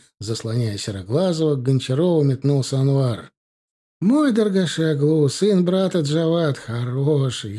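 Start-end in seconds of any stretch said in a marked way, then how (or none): 4.0-6.6 higher, slow eager pleading; pleading merchant. Acted. Merchant vouches for nephew to officer, slow high plea. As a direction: talk him into trusting the boy.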